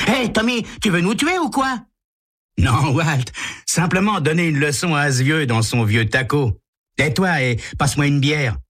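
put-on high boyish voices trading jabs; teenage joyriders. Two teens bicker in a speeding car, high and cocky. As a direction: play two reckless teens arguing over beer.

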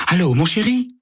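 high, honeyed lover's voice; woman phoning her secret lover in a strange sweet voice; unrecognisable sweet voice. A narrator's reading. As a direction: coo it, voice changed beyond recognition. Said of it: "d'une voix que Sam a du mal à reconnaître".

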